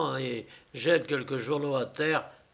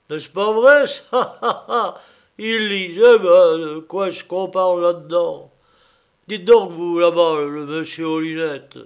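boisterous taunting reveler, laughing at them; loud mocking drunk; 4 semitones higher, 9 dB louder than usual. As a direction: jeer at the readers, loud and rowdy. Masked man taunts, laughing. (ivrogne moqueur et bruyant)

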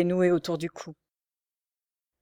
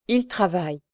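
proud. A narrator's reading. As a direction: savour «Il travaille» as a boast. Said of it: "avec une expression d'orgueil".

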